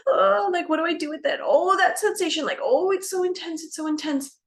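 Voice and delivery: high, gasping imitation of alarm; hammed-up flustered overwhelm, pitch jumping up; mock startled. Imitating someone feeling the urge to push, mock startled. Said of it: "it's a little bit startling".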